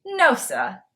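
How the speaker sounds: "No, sir" as Boston "no suh"; punchy Boston exclamation with the r dropped; Boston accent. Shown as a demonstration. Vlogger showing the Boston expression for no way, Boston accent. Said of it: "a guide on how to speak Boston".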